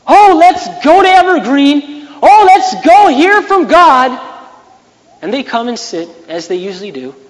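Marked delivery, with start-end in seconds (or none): 0.0-4.2 much higher and louder, slow, sing-song parody; a preacher mocking his own flock's cheerful chatter; mock eager churchgoer. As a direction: ham up the shallow excitement of the crowd.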